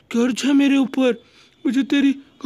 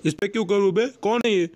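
ordinary talk, nothing marked

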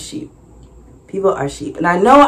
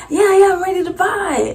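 returning customer; 12 semitones higher than usual. Retold or imitated. Returning man voiced.

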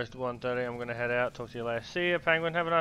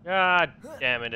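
frustrated; 5 semitones higher, 8 dB louder, slower than usual. Real, not acted. Frustrated gamer curses.

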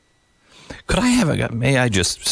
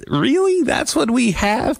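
incredulous; 13 semitones higher, slower than usual. Real, not acted. Mocking the FBI advice.

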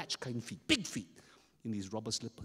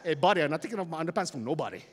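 indignant refusal; 3 semitones higher, 7 dB louder, much faster than usual. Acted. Boy flatly refuses his friend.

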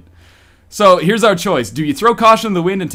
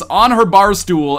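plain reading voice

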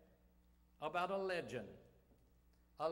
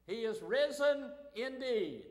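loud, high answering call from afar; loud reply; 6 semitones higher, 8 dB louder than usual. Retold or imitated. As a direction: call it back across the hills. Hidden friends call back from hills.

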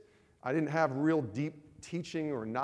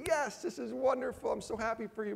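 imagined happy wife; 9 semitones higher than usual. Retold or imitated. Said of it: "I was hoping that she'd just say".

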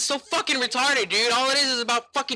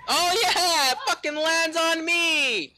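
higher, drawn-out exasperation; sarcastic whine; 6 semitones higher, 5 dB quieter, slower than usual. Real, not acted. Gamer watching the explosive land on him, sarcastic whine. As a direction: stretch it into a bitter whine.

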